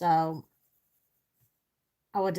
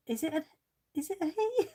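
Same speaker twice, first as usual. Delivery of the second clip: surprised query; 10 semitones higher, faster than usual. Real, not acted. Unsure of the artist's gender.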